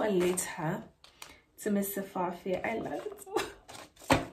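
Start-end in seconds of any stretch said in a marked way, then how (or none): 2.6-4.2 breathy gush. Real, not acted. Gushing over principal's gift.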